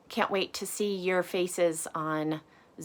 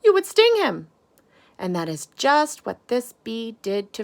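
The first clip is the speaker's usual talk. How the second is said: lively storybook voice, big swings up and down; animated read-aloud for a class; reading to children; 10 dB louder than usual. A narrator's reading. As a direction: read it out like bedtime story time for little ones. Reads picture book to children.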